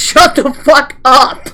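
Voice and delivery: ordinary talk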